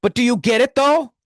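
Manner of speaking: insistent. Acted. Pushing the pun again.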